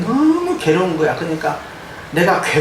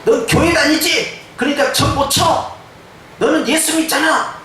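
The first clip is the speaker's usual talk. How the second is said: the shaman seeing through her, amused; fortune teller; 8 semitones higher than usual. Retold or imitated. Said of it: "저쪽을 딱 보면서요". (높고 느린 점쟁이 흉내)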